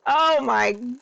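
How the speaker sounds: shrieking high at the end; overexcited fan squeal; fangirling. Real, not acted. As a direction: build into an ecstatic scream.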